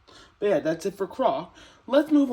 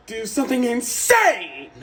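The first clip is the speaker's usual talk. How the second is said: higher, louder burst of craziness; insane voice; 6 semitones higher, 6 dB louder than usual. Shown as a demonstration. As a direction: go crazy on the last word, pitched up and loud. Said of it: "more of an insane voice".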